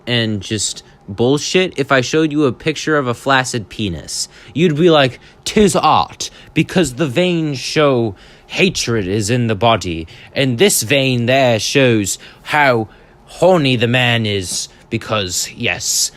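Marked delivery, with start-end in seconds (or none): 5.4-16.1 hammed-up, badly faked British accent; terrible British accent. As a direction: put on a bad British voice for an art snob. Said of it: "gave him a British accent".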